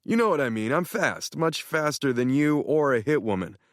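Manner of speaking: a hint of a smug buddy; boastful Tanner. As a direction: brag it with a grin. Tanner's voice in story.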